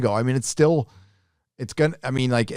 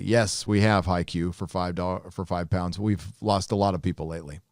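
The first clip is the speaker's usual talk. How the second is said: ordinary talk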